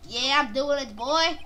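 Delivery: normal talking voice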